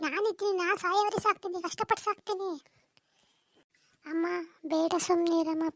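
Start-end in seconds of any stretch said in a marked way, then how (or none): 0.1-2.5 softer, fading vow of support; reassuring promise. Acted. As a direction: comfort her quietly. Brother comforts sister in quarrel.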